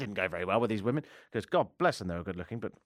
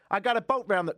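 put-on New York accent, higher pitched; 8 semitones higher than usual. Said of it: "you need a New York accent".

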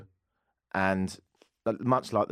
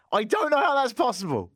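high-pitched incredulous outburst; exasperated disbelief; 13 semitones higher, 4 dB louder than usual. Acted. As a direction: exclaim it high in comic bafflement. Mock disbelief about his sister.

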